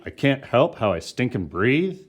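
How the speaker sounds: mock scream. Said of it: "I heard you from here".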